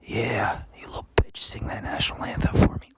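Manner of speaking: whispered jeering right into the ear; Roger's taunting whisper. Retold or imitated. Classmate behind him at graduation talking trash in his ear, whispered. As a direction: imitate a sinister whisper over someone's shoulder. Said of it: "talking shit on me".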